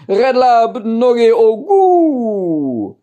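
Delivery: name reversed, slow, high and loud; backwards speech. Shown as a demonstration. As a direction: call out the reversed name slowly. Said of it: "dem seinen Namen ... rückwärts gesagt". (laut und langsam rückwärts gesprochener Name)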